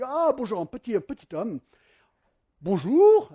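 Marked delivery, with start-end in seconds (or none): 0.0-0.6 higher, louder jolly baker greeting; cheerful baker. Retold or imitated. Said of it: "Alors, il dit". 2.6-3.3 much louder, higher booming baker bonjour; loud baker greeting. Retold or imitated. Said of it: "Alors, il dit".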